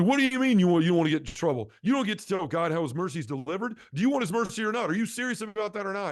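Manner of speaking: high-pitched, worked-up mock tirade; imitated angry scolding. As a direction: fire indignant questions like an outraged priest. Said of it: "he's screaming at me".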